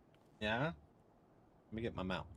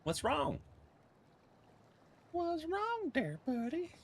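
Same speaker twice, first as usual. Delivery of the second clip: high, slow pet talk; slower than usual. Real, not acted. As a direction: coo to the dog. Doting voice to dog.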